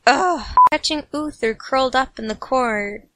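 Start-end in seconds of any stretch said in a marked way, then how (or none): none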